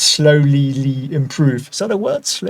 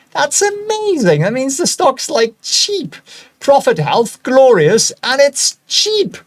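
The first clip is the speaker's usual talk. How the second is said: excited high voice; 8 semitones higher, slower than usual. Real, not acted. Trader gushing over a cheap stock, excited high-pitched voice. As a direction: pitch shoots way up with glee. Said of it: "Did I say that in a rather high-pitched voice?".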